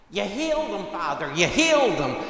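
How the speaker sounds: the grandmother calls, thrilled, with the news; overjoyed grandmother. Retold or imitated. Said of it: "It was the grandmother again".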